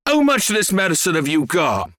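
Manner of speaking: a touch of the eager farmer; excited shout. A narrator's reading. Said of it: "Mister Cranky shouted".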